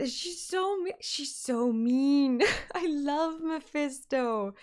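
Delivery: mock-whiny drawn-out sulk; whiny complaint. Real, not acted. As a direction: pout it out.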